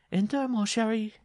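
high, loud call to a sweetheart. Acted. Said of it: "she called".

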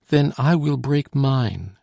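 defiant vow. A narrator's reading. Solange refusing to leave her lover, defiant vow.

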